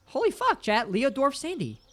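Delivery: high-pitched astonished exclamation; shocked disbelief. Real, not acted. Streamer reacting to who is left, high and shocked.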